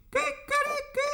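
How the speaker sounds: high crowing kikeriki; rooster crow. A narrator's reading. Rooster on the gate crows with all his might. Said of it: "schrie aus Leibeskräften".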